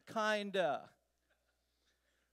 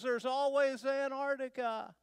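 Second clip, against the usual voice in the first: called out louder from a distance; hollered back; 6 semitones higher, 5 dB louder than usual. Retold or imitated. Hiker shouting back to the rider while walking away, loud call. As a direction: holler it back over your shoulder as you walk on. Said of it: "One hollers back".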